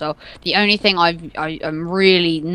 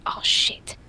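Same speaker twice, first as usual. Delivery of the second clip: whispered curse; 7 dB quieter than usual. Real, not acted. Mutters while on Amazon.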